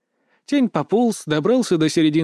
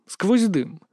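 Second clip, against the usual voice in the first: ordinary delivery, nothing marked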